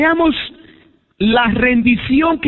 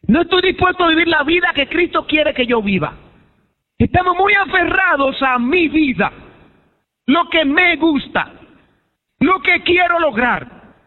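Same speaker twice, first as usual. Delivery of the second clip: higher-pitched, insistent pulpit delivery; impassioned preaching; 8 semitones higher than usual. Real, not acted. Preacher voicing believers clinging to their own life, impassioned. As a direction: preach it as a forceful confession.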